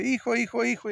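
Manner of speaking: loud, high mock call; mock mother's cry. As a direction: imitate a mom shouting for her son. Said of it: "la señora dice". (grito fingido de mamá, fuerte y agudo)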